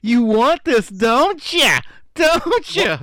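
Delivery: high loud teasing taunt; taunting cameraman. Retold or imitated. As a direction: dangle the sandwich and tease. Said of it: "And he goes".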